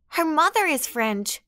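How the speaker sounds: high, spiteful jab; venomous. A narrator's reading. Sandra jabbing at Maria's mother, high and venomous. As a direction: spit it out with venom. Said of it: "so much venom in her voice".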